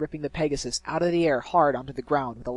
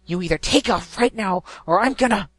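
angry threat; 6 semitones higher, 9 dB louder than usual. Acted. Dash threatening Gilda.

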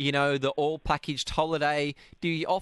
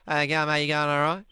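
mumbled Aussie; 4 dB louder than usual. Shown as a demonstration. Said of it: "You just have to mumble a bit more".

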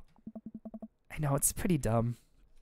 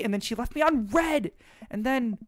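put-on higher, lively teenage girl venting; higher teen girl voice, irritated; teen daughter voice; 7 semitones higher than usual. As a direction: play the upset teen daughter ranting. Voices Amanda again.

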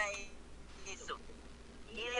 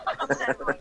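repeated much lower, in a put-on voice; imitating the pastor; 10 semitones lower, faster than usual. Pastor begging her for his own deliverance, lower mock voice. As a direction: drop low to mimic the pastor pleading. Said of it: "me dijo".

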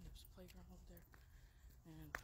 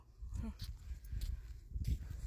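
laughing; 11 dB louder than usual. Real, not acted. Laughs after reading the love note.

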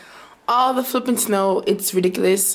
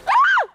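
sudden high scream as feet slip; screaming while falling; 5 dB louder than usual. Real, not acted. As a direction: yelp of real fright on ice. Slipping again on snow.

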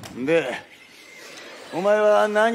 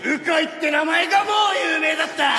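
excited shout; 7 semitones higher than usual. Acted. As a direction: blurt it out in awe. Excited fan recalling famous coach.

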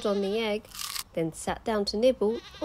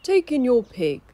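put-on wise old sage; 5 semitones higher, 9 dB louder than usual. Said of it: "said the wise old man".

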